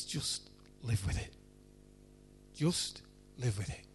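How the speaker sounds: God's answer to sufferers, barely whispered; hushed voice of God. Acted. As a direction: breathe it quietly, settled and final.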